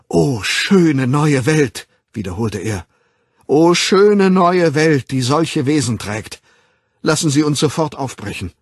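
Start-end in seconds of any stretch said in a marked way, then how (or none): 0.1-1.9 loud, slow, high cry of joy; joyful exclamation. A narrator's reading. Young man laughing for joy, exclaiming rapturously. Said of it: "lachte vor Freude". 3.5-8.5 very loud, high, exultant declaiming; joyful exclamation. A narrator's reading. Young man eager to leave with Bernard, loudly jubilant. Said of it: "lachte vor Freude".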